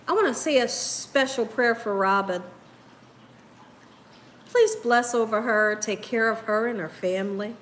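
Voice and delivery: low, earnest prayer voice. Real, not acted. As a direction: pray sincerely for a sick friend. Leads a prayer for Robin.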